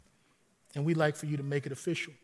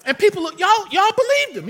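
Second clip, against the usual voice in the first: much higher and louder, laughing disbelief; excited exclaim; 12 dB louder than usual. Real, not acted. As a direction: burst out in delighted disbelief. Laughing recount of pastor's prank.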